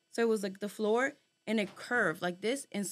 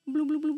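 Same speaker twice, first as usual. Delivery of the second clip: rising bloom sounds, a touch louder; imitated blooming hum; 6 semitones higher, 5 dB louder than usual. Telling friends about the strange room, imitating the pods' bloom sound. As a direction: hum it like a glowing pod. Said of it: "the sound was kinda like".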